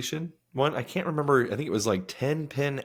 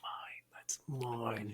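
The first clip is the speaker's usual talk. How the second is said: possessive whisper trailing off; whispered mine; 2 semitones higher, 6 dB quieter, slower than usual. Retold or imitated. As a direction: breathe it like claiming a prize. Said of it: "You're like".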